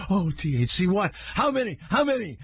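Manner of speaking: high, startled mock questioning; alarmed surprise. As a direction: friends alarmed, demanding a number. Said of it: "I heard them both go".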